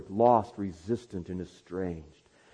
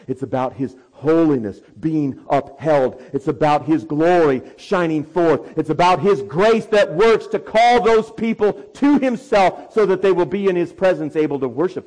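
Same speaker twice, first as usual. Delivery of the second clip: louder, higher rising declarations; preaching at full force; 5 semitones higher, 10 dB louder than usual. Acted. Preacher proclaiming God's grace to the church, swelling loudly. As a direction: climb each phrase toward glory.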